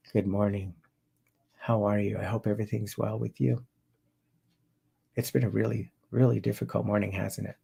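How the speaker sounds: subdued greeting with long pauses; depressed client. Shown as a demonstration. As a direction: talk as if weighed down, trailing off softly. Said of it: "from a depressed client".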